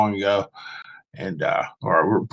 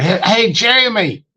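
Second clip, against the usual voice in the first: imitating a caller's Southern twang, higher; a Southern drawl on the name, mock friendly; 10 semitones higher than usual. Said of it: "he had this Southern twang or something".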